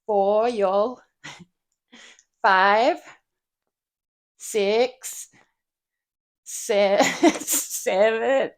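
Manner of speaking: loud, hammed-up American counting; struggling fake American, punched out loud; American accent. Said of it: "I can't do counting in American".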